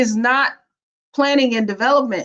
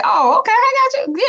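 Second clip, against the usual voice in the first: much higher, sing-song healer reassuring; 13 semitones higher, faster than usual. Acted. Healer comforts the bitten zombie in a high sing-song voice. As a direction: chirp it up high and kindly.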